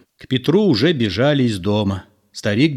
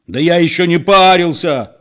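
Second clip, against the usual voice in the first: loud, raised yell; 7 semitones higher, 8 dB louder than usual. A narrator's reading. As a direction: bellow it in outrage. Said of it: "заорал спокойный Петро".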